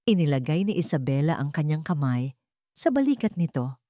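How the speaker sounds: ordinary delivery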